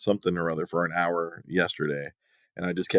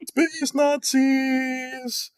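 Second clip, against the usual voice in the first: mock epic announcer; much slower than usual. Mocking movie pitch.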